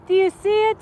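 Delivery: high, loud and eager; can barely contain the thrill; excited asking. Real, not acted. Asking the viewers to spot it, excited and high.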